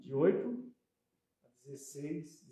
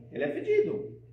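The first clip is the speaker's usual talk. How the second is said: higher, mocking repeat of "fedido" with a rise; mock disgust; 12 semitones higher, 6 dB louder than usual. Talking to an audience about street people, higher mock disgust. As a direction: sneer it out like a snob.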